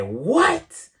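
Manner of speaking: high-pitched, astonished "what?"; retold stunned exclamation; shocked disbelief. Retold shock at three weeks driving.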